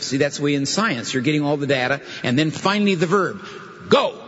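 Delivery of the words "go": high, punchy order; emphatic command. Shown as a demonstration. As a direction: GO as a sudden high burst. Verb finally arrives after data.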